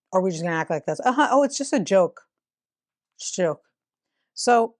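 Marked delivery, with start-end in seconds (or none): 1.0-3.6 sarcastic mock.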